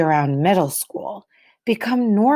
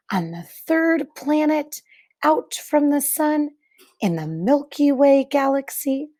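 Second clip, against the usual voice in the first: put-on reading to children; 2 semitones higher than usual. Teacher reading a picture book to the class, hushed and wondering.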